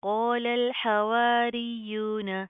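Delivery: slow chanted tajweed, heavy qaaf; Quranic recitation. Shown as a demonstration. Teacher recites the verse for students to copy. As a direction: chant क़ालल slowly with a thick qaaf. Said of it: "recitation demo".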